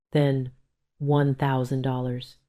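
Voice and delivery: ordinary delivery, nothing marked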